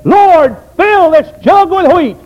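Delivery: high, loud, slow pleading cry; intense prayer. Retold or imitated. Old woman praying intensely, shouting high and slow. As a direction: cry out to God at full force. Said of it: "she really got intense".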